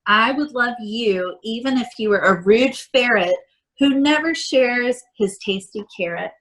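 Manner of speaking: usual speaking voice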